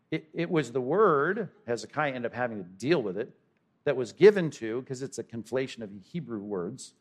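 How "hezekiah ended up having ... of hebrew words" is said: ordinary talk, nothing marked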